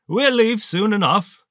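high, loud, sneering villain voice; towering armored colonel greeting a lieutenant, high and loud; menacing colonel. Acted. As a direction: let it cut like a rusty saw blade. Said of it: "like a rusty saw blade slicing through your spine".

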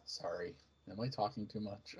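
suddenly low and soft; sheepish apology. Acted. Padawan asking if she talks too much, sheepish and hushed. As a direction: drop right down, as if embarrassed. Padawan catches herself over-talking.

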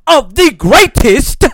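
high, loud, drawn-out hype call; hype shout. Acted. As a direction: belt the big finish. Intro climax.

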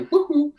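sudden high, loud "woohoo"; high whoop. Retold or imitated. Man telling how he blacked out, high loud whoop.

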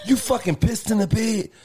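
high, pushed accusation; mock accusing yell. Boyfriend retelling the blame, mock yell. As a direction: fling the blame at her.

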